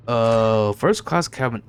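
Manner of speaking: ordinary talk, nothing marked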